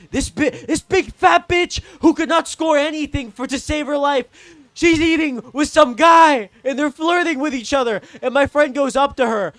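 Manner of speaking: loud, high-pitched tirade; worked-up yelling; outraged rant. Real, not acted. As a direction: shout the story in outrage. Ranting about catching her cheating.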